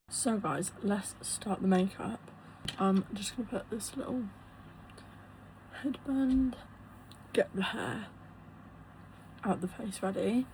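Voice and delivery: hoarse, voice going, very soft; losing her voice, husky and faint. Real, not acted. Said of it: "Sorry if my voice sounds like it's going".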